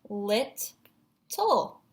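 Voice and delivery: LITTLE broken in two, lit, pause, tle; LITTLE in syllable chunks, lit ... tle; split into syllables. Shown as a demonstration. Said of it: "looking for the syllable chunks".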